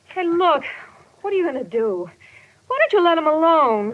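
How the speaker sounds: high, frightened pleading; rising panic for Johnny; alarmed plea. Acted. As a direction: beg them to stop, scared. Woman fears for tied man.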